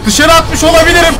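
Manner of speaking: ordinary talk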